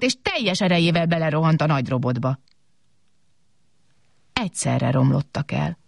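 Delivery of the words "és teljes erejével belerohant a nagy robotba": plain reading voice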